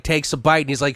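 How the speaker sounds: usual speaking voice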